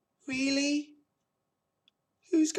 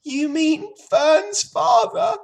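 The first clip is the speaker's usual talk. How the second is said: high, loud, upset pig voice; distressed piglet; 11 dB louder than usual. A narrator's reading. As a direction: ask in dismay as the pig. Sobbing pig asks on.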